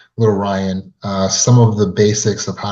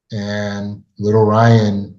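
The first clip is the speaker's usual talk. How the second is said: ordinary talk, nothing marked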